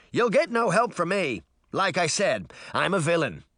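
put-on high, cocky boy taunt. A narrator's reading. Boy refusing to help girls, taunting. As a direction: taunt high, settling as it goes. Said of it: "Puck taunted".

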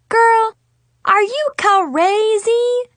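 high, loud, drawn-out screech; shrieked exasperated question; screamed. A narrator's reading. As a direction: scream it at your friend in disbelief. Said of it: "Zoe screamed".

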